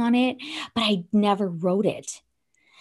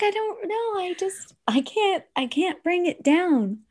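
much higher, stuck up in a high register; high-pitched voice; 10 semitones higher than usual. Retold or imitated. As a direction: squeak it out, unable to bring the pitch down. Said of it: "I couldn't bring the octave down".

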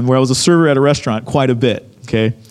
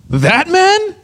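high-pitched, incredulous guard; shocked disbelief; 11 semitones higher than usual. Retold or imitated. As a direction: imitate someone stunned at who was pointed out. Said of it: "he was in shock".

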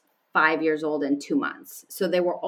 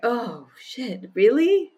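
shocked, rising to a higher, louder really; startled realization; 6 semitones higher, 4 dB louder, slower than usual. Retold or imitated. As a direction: caught off guard by her own answer. Sudden realization about peace.

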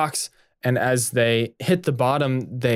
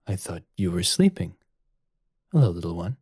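gentle; 6 semitones lower than usual. Acted. Oturo gently greets the baby.

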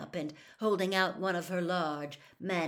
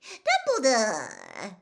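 loud, drawn-out gushing greeting; delighted cry; 5 semitones higher, 10 dB louder, much slower than usual. Acted. Reporter cries out in showy delight at the headmaster. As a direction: feign great delight. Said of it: "cried with every appearance of delight".

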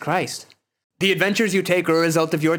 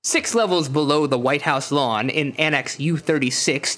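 ordinary talk, nothing marked

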